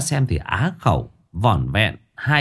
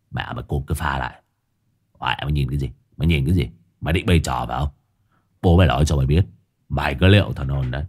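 low gruff scolding; 7 semitones lower, faster than usual. Acted. Hungover man cursing his dog, low gruff scolding. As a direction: voice Hải Nghẹo low and rough, cursing the dog.

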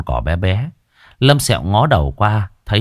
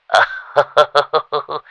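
chuckle; 6 semitones higher than usual. Acted. Old man laughs at himself.

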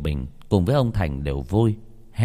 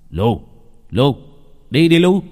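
loud, much higher shout at the dog; calling the dog; 5 semitones higher, 7 dB louder than usual. Acted. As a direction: yell the dog's name to make it move. Owner urges his dog on.

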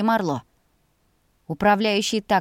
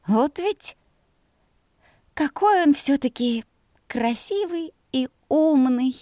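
higher-pitched, slowed, lovestruck swooning; dreamy sigh; 6 semitones higher, slower than usual. A narrator's reading. Maid admiring her mistress's brother, dreamy sigh. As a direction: linger on each admiring word, sighing. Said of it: "мечтательно вздохнула девушка".

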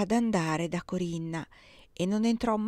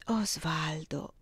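slow breathless whisper of his name; 2 semitones lower, 5 dB quieter, much slower than usual. A narrator's reading. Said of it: "esclamò pure Corinna". (sussurrato lentamente)

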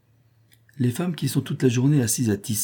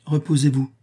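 coldly given, higher-pitched order; 6 semitones higher than usual. A narrator's reading. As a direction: dismiss the complaint coolly. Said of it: "dit froidement Eugénia".

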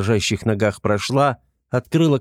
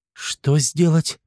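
surprised question; 6 semitones higher, much slower than usual. Acted. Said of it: "переспросила девушка".

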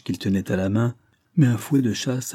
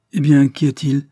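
louder, higher, startled question; 5 semitones higher, 8 dB louder than usual. A narrator's reading. Aunt interrupted by a tumult upstairs, startled question. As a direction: break off with sudden alarm.

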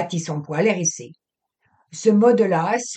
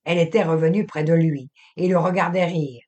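ordinary delivery

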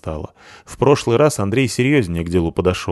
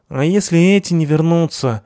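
higher whining thug voice; whiny thug; 9 semitones higher than usual. A narrator's reading. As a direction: nag it in a whine. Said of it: "продолжал нудеть второй".

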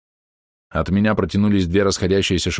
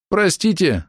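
high, sorry shout; penitent cry; 11 semitones higher, slower than usual. Acted. Alchemist shouts his apology to the team. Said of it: "с покаянием крикнул".

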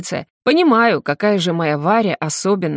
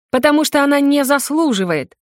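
shouting; 8 semitones higher than usual. A narrator's reading. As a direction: yell it in answer. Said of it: "кричит в ответ".

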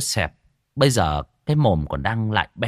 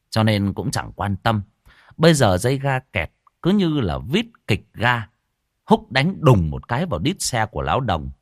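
plain reading voice

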